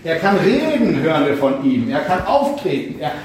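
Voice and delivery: ordinary talk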